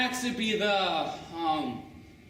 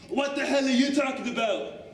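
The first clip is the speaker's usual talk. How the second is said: higher, baffled tough-guy voice; Axe, baffled; 6 semitones higher than usual. Acted. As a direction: play Axe lost and irritated. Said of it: "asked Axe".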